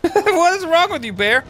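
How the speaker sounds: laugh, then high-pitched incredulous question; amused disbelief. Real, not acted. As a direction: squeal it at the baffling animal. Laughing at odd bear.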